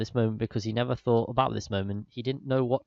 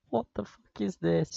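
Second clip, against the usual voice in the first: disbelief; 8 semitones higher than usual. Real, not acted. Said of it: "Oh no".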